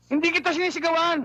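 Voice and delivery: denying he's yelling. Acted. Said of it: "bakit ka ba sumisigaw". (pasigaw na pagtanggi)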